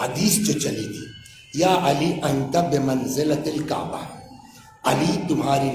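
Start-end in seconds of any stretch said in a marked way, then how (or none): none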